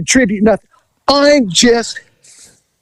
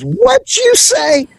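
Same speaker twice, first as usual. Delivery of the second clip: high, drawn-out incredulous retort; indignant challenge; much slower than usual. Retold or imitated. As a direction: imitate her affronted comeback, pitched way up. Retold woman's indignant comeback.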